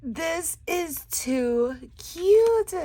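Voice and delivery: high, loud, very slow gushing; drawn-out squeal. Real, not acted. Crafter gushing over a tiny bag, slow squeal. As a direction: stretch every word with delight.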